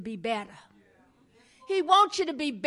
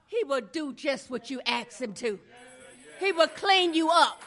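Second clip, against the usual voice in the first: usual speaking voice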